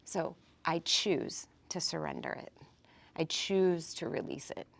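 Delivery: low, hushed self-talk, resigned to not knowing; quiet surrender. Acted. Speaker models telling the brain to surrender, quiet and low. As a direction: speak softly and low, letting the puzzle go.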